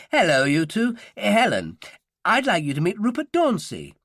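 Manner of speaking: greeting professor. A narrator's reading. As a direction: hail them and make the introduction. Professor greets and introduces students.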